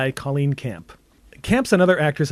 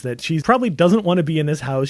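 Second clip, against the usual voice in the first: usual speaking voice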